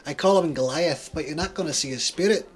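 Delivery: loud, high Scottish boast; gloating Scottish accent; thick Scottish accent. Acted. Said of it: "He has a thick Scottish accent".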